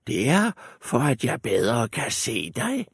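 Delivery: wolf playing grandmother; wolf as granny. A narrator's reading. Wolf answers the girl, posing as granny.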